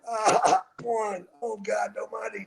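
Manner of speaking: counting while hurting; pained counting. Retold or imitated. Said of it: "Couldn't fucking do anything".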